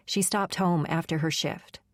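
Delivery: ordinary delivery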